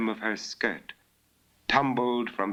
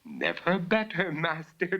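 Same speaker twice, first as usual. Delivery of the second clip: called back; 9 semitones higher than usual. Acted. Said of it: "Bella called back".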